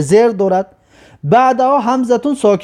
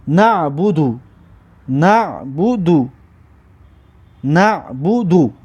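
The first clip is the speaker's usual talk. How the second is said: slow, lower-pitched "نه بو دو", repeated; syllables joined up; 5 semitones lower, much slower than usual. Shown as a demonstration. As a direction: read the joined word back slowly for learners. (خواندن آهستهٔ هجاهای پیوسته)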